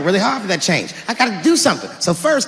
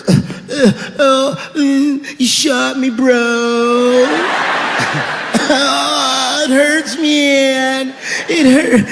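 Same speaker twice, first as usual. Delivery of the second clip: a lightly voiced, higher whimper; whiny dying addict; 4 semitones higher, much slower than usual. Shot addict whining his last words, mock whimper. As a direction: moan it like a dumb dying junkie. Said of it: "saying stupid heroin last words".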